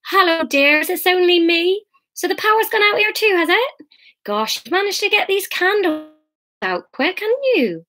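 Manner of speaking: put-on high-pitched little old lady voice; chirpy old neighbour. Old neighbour shuffling into the lounge, chatty high voice.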